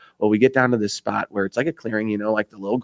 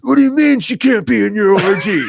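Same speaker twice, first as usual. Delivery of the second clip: high, loud mock indignation; screaming husband; 9 semitones higher, 5 dB louder than usual. Husband yelling at the buddy about his wife, comic outraged shout. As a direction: imitate an outraged yell, played for laughs. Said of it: "the guy's screaming at him".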